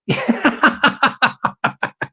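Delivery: breathy run of chuckles; laughing. Real, not acted. Host cracks up after singing to his crew, laughing. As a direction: laugh in a long rapid string.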